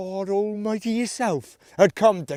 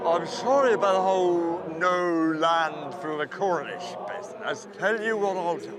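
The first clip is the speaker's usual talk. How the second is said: low and soft; a contrite deity making amends; a quiet, deep, sheepish divine voice; apologetic God; 5 semitones lower, 7 dB quieter than usual. Acted. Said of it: "he bespoke again".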